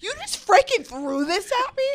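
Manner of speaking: high, loud mock accusation; retold scream. Holding up the card to friend, high loud mock outrage. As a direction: yell it in hammed-up outrage. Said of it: "Screams down the whole train".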